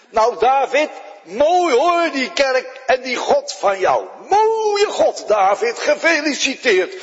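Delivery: sarcastische spot tegen David, hoger; spottend. Acted. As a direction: schamper en honend. Nabal spot met David. (spottend tegen David: hoger en schamper)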